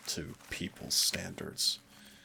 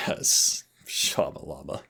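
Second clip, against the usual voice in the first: mock disbelief; 4 semitones lower, 4 dB louder, slower than usual. Mocking the silly contract name.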